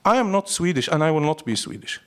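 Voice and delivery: usual speaking voice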